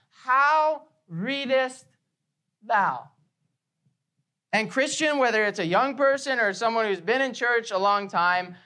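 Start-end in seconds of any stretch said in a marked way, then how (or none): 0.1-3.1 slow accusing question to the man; pointed slow question. Retold or imitated. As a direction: press it home word by word. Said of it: "puts the responsibility squarely on this man".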